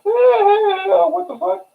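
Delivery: high squeaky shocked cry. Retold or imitated. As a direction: shriek it in disbelief. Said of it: "I'm sitting there like".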